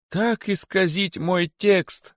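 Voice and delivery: high, very drawn-out outrage; indignant exclamation. A narrator's reading. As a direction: lament it like a wounded poet. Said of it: "воскликнул поэт". (возмущённое восклицание поэта)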